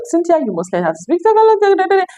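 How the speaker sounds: fast, high imitation of nagging; mock nagging. Dear ones urging Cynthia to learn Tagalog, mock nagging. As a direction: pester her in a high rush, trailing into da-da.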